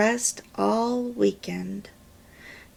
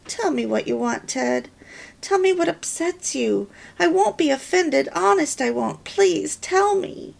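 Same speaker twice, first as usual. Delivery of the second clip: rising, pleading wife, higher as she begs; 8 semitones higher than usual. A narrator's reading. Wife begging her husband on the phone, pleading higher. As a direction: beg him to open up, climbing higher.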